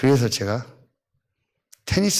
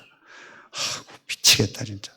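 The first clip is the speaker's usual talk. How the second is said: whispered, fed-up sigh of words; exasperated whisper; 3 semitones lower, 4 dB quieter, much slower than usual. Real, not acted. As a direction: mutter it to yourself, at wit's end. Exasperated aside.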